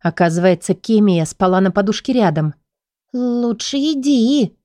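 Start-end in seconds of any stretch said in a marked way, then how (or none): 3.1-4.6 high, slow, frightened fairy voice; a scared little fairy urging her to go; pixie voice. A narrator's reading. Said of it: "Голос феи преисполнен ужаса".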